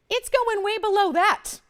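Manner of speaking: high, loud, drawn-out sales pitch; excited price hype. Real, not acted. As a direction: sell the drop like it's unbelievable. Host hyping a price drop.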